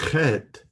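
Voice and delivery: Chet with a guttural, throaty first sound, high and loud; back-of-the-throat Chet. Shown as a demonstration. Teacher naming the next Hebrew letter, guttural Chet. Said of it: "pronounced at the back of the throat".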